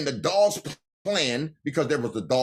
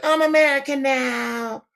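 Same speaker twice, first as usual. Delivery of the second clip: high, mocking put-on voice claiming to be American; mocking immigrant; 11 semitones higher than usual. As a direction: sneer it out in a high mock voice.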